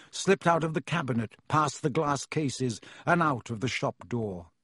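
usual speaking voice

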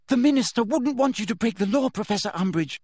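high, urgent plea; crying out. A narrator's reading. Girl begs teacher to obey the law, crying out. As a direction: plead urgently, pitched well up. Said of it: "cried Hermione".

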